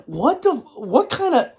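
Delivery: high, drawn-out disbelief; slow, high-pitched stunned sputter; taken aback. Retold or imitated. Telling of a stranger's insult, retold stunned disbelief.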